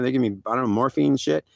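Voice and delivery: plain reading voice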